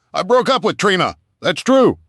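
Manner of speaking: high, loud sharp retort; angry ex snapping; snapped. A narrator's reading. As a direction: snap it back at him. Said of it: "she snapped".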